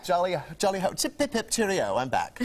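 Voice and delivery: hammed-up upper-class British, chirpy and high; playing the toff Englishman for laughs; jolly mock British accent; mock posh British. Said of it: "give me your accents that you've learned".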